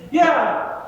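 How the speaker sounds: "Yeah" jumps up high and eager; eager kid's yeah. Retold or imitated. The other kid chimes in eagerly, a high quick yeah. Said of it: "the other kid's gonna say".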